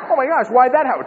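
high, loud exclamation; mock bafflement. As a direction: play a flustered sceptic, voice jumping up. Mimics baffled sceptics.

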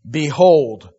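loud, very slow proclamation; solemn scripture reading. Acted. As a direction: proclaim it like the voice from the throne. Reading God's words.

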